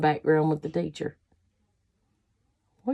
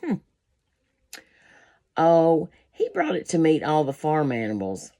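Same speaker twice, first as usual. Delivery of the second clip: plain reading voice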